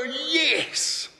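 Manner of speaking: high-pitched, emphatic affirmation; excited, rising well above normal pitch; exultant yes. Retold or imitated. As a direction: celebrate it with a drawn-out cry. Exults at a profound truth.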